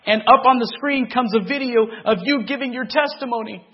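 high, loud, desperate voice; father calling his son, loud high distress; distraught father. Retold or imitated. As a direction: sound frantic and shaken.

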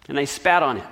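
plain reading voice

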